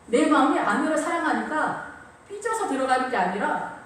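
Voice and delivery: usual speaking voice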